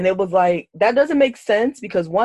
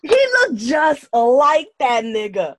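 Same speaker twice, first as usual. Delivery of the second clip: amused emphasis; 7 semitones higher, 6 dB louder than usual. Real, not acted. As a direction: exclaim it high, stretching the words. Amused rant about doll lookalike.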